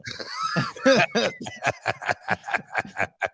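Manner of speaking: long, high-pitched burst of laughter; drawn-out high cackling laugh; hearty laugh. Real, not acted. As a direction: crack up after teasing a friend. Co-host laughs after teasing.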